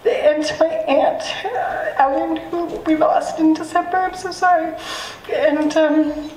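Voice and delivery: real tears welling up as she speaks; close to tears. Real, not acted. Poet thanking her mom and late aunt, near tears. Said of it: "I'm sorry, I'm just gonna cry".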